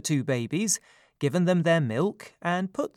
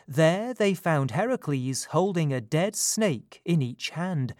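ordinary delivery

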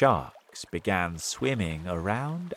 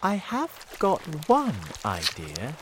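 hesitant, higher-pitched voice; said slowly; 9 semitones higher than usual. A narrator's reading. James offering his friends an idea, said slowly. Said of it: "James said slowly".

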